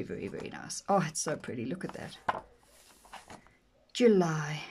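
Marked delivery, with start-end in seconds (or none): 0.0-0.8 whispered praise. Real, not acted. Trailing hushed praise. 1.6-2.2 whispered. Real, not acted. Trailing hushed praise.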